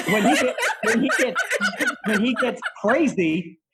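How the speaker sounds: high, laughing through the words; cracking up while telling it; breaking into laughter. Real, not acted. Laughing retelling of crazy friend.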